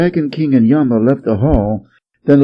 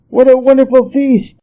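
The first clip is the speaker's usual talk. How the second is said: much higher and louder, thrilled; delighted Monkey; 11 semitones higher, 5 dB louder than usual. A narrator's reading. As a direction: gush over the food.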